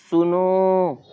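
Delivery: सुनो with a long-held final ओ; प्लुत स्वर. Shown as a demonstration. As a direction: pitch सुनो high and stretch its last vowel. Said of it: "प्लुत स्वर में आ जाती हैं". (सुनो को ऊँचे सुर में लंबा खींचें)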